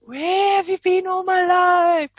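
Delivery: mock swooning delight. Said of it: "you're gonna be like".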